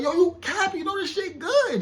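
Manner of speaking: very high, loud playful accusation; incredulous calling-out. Retold or imitated. Man catching his date enjoying the yogurt, incredulous. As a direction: squeal it in mock outrage at a fib. Retold calling out her lie.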